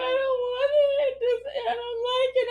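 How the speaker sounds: put-on a mild whine from the tearful kid; crying kid voice. Mom voicing crying daughter, high mild whimper.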